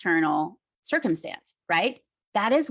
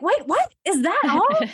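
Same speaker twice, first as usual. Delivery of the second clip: incredulous; 9 semitones higher, 4 dB louder than usual. Retold or imitated. Disappointed reaction to husband's reply.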